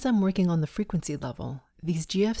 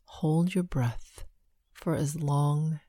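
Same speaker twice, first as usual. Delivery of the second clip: slow, hushed breath counting; slower than usual. Acted.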